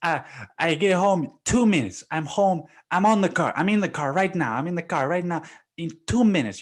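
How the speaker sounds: high, fast pleading on the phone; hammed-up placating husband; mock pleading boss.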